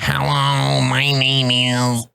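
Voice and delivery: slow mechanical electrolarynx voice; robot voice, slow and even. Shown as a demonstration. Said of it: "the voice is like".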